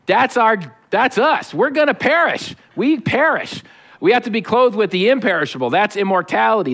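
ordinary talk, nothing marked

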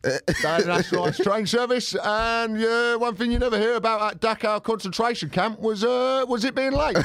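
hammed-up high Mike Lynch voice; Mike Lynch impression. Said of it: "Imagine Mike Lynch went on T V and went".

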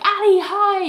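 high, gushing hello; excited greeting. Retold or imitated. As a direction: imitate an overexcited man greeting someone. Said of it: "Eric's really excited to see Ali".

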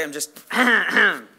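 strained, worn-out hoarse voice. Real, not acted. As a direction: speak through a hoarse, tired throat. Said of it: "I'm losing my voice".

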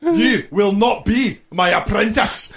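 furious Scottish boss shouting high; angry boss. Acted. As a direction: bellow it like a raging Scottish boss. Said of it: "Alan Sugar is not that Scottish".

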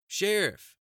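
high, loud exclamation, falling; scandalized. Acted. Secretary shocked by sheriff's crude retort, scandalized. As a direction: exclaim it in reproach.

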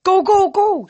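high, loud barked orders; urgent shout. A narrator's reading. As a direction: yell them all forward. Coach urging kids in storm.

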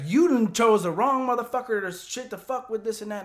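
loud, high-pitched retold tirade; angry yelling. Truck owner threatening the newcomer in the truck bed, angry yelling.

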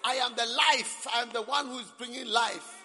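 high, loud shouted rally speech; mock campaign boast. Preacher mocking an election candidate, loud mock rally boast. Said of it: "stood for elections and said".

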